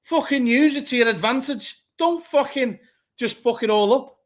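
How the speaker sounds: pundit scolding the manager, pitched high and heated; exasperated telling-off. Acted. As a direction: scold the manager as if he were in the room. Ranting directly at the manager.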